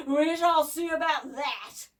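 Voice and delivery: loud, slow, scratchy threat; menacing mechanical giant; snarling scratchy voice. A narrator's reading. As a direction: snarl it out slowly and big. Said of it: "snarled the scratchy voice".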